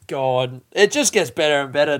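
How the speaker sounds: usual speaking voice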